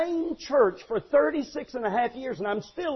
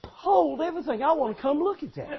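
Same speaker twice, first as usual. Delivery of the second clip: a bright, delighted wife dropping everything; mock-excited "Hold everything", a laugh in it; coaching wives to sound thrilled; eager wife; 6 semitones higher than usual. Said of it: "you say".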